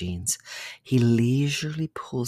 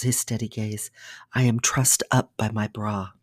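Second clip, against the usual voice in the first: usual speaking voice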